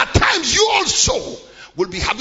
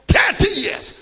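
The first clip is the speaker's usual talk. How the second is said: high, loud cry of the phrase; shouted; 10 semitones higher, 5 dB louder than usual. Acted. Preacher crying out to the congregation, high and loud. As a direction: throw it out to the crowd.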